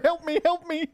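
high, laughing fake wail for help; mock cry for help. Mocks the friend's panic.

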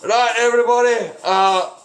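a leader rousing everybody in the morning; Dawn rallying the camp. Acted. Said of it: "Dawn is up there and he's like".

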